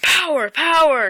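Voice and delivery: high, loud excited cry; retold scream. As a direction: imitate yelling out the big news, high and loud. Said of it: "I just screamed".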